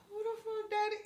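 high, hushed gasp of interest; half-whispered, impressed aside; stunned by a fine dad; mock awed whisper. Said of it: "I was like".

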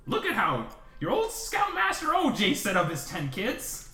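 high, boastful camp leader; proud scoutmaster voice. Acted. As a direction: brag like a cocky scout leader. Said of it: "Ollie just looks like this big".

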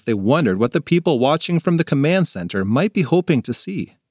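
ordinary delivery

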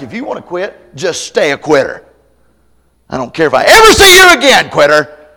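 building to a loud high yell; rising to a fed-up shout; angry dismissal. Retold or imitated. Paul telling Mark off, building to a yell.

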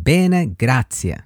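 "Bene, grazie" said slowly, each word drawn out; slow teaching read of "Bene, grazie"; slow repeat-after-me. Shown as a demonstration. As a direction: drag out "Bene, grazie" so learners can copy it. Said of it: "Then you have".

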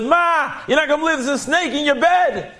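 high, panicked cry about a snake; girl running down to warn her mother, alarmed; frightened shriek. Retold or imitated. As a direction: burst in alarmed, voice way up. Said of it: "She was shrieking".

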